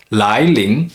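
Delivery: slow 来临, each syllable rising; two second tones. Shown as a demonstration. Teacher giving an example word, 来临 in slow rising tones. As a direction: draw out 来临 with a lift on each syllable. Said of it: "two consecutive second tone syllables".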